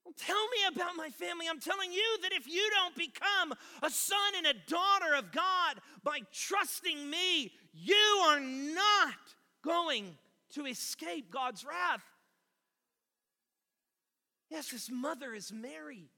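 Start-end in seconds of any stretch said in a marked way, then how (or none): 0.0-12.0 loud, high, forceful declaration; acted impassioned preaching shout; shouted rebuke. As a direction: thunder it at the crowd, stretching key words. Jesus rebukes the crowd, acted.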